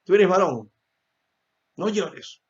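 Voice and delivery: put-on high, loud stern scolding of a crying boy; a parent snapping at a crying son to stop. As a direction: tell the boy off like a strict father. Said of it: "¿Qué le decimos a los niños cuando están llorando?".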